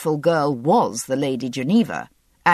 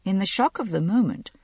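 plain reading voice

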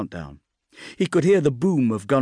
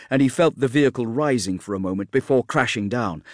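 ordinary delivery

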